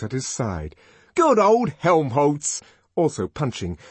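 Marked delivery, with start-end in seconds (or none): 1.1-2.6 loud, slow, high-pitched cheer; elated shout of approval. A narrator's reading. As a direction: hail a friend joining the fight. Savage cheers his fighting friend.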